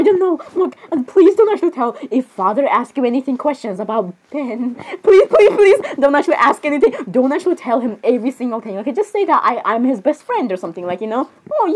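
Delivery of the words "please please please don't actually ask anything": pleading. Acted. Begging friends not to tell.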